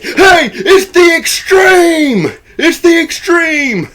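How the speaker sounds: very high, drawn-out shout, imitating a wild buddy; hollered hype greeting. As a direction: holler the nickname like a hype man, stretched out. Acting Dusty hailing his friend.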